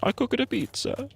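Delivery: squeaky high mock Italian accent; cartoon Italian accent, very high; mock Italian Pope. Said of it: "Except he's Italian or something".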